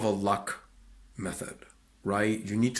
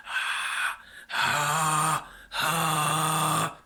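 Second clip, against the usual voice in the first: whisper scream; 6 semitones higher, much slower than usual. Shown as a demonstration. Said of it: "like it's going through a high pass filter".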